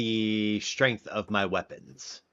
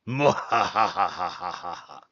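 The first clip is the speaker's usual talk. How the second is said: mad scientist; 4 semitones lower than usual. Pretend. Chose violence, then cackles.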